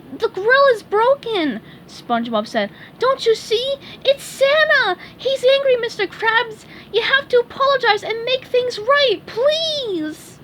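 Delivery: high, loud frightened sponge; scared cry. Acted. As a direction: cry out in alarm. SpongeBob pleading in fear.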